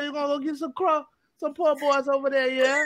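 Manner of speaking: playful imitation of New Orleans talk; mock New Orleans accent. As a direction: tease the in-laws with their New Orleans accent. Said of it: "I make fun of the way how they talk".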